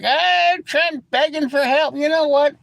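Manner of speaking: mock the guy from the channel; a hint of sarcastic taunting; mocking quote. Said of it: "he was like".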